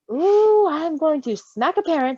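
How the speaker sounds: loud, high, outraged gasp; indignant outburst. Real, not acted. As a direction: fume at the parents, half joking. Indignant outburst at hurt baby.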